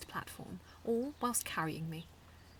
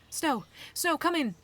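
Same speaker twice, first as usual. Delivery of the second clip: high, loud urgent call; 9 semitones higher, 10 dB louder than usual. A narrator's reading. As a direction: call out into the radio. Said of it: "I called out to my Medawatch".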